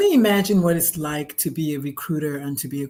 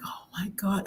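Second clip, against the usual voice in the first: whisper; 9 dB quieter than usual. Retold or imitated. Retold whispered reaction.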